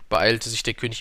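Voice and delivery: ordinary delivery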